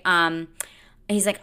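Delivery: ordinary delivery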